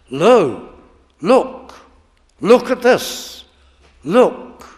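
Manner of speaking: short loud cries, each set apart; urgent exclamation. Acted. Preacher voices the exclamation lo.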